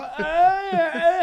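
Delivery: high, loud fake moan of pleasure; hammed-up moaning. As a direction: moan it up for passing cars. Buddy faking sex sounds.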